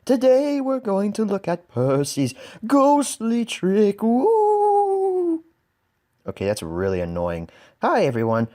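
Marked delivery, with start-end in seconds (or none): 0.0-4.0 high, slow, eerie ghost voice; drawn-out spooky ghost voice. Pretend. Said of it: "just like that ghostly voice said". 4.0-5.4 high, wavering ghost moan; a spooky high-pitched ghost wail; ghostly ooh. Pretend. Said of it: "that ghostly voice said".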